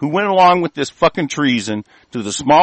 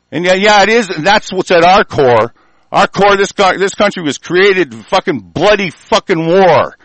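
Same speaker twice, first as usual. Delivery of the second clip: normal talking voice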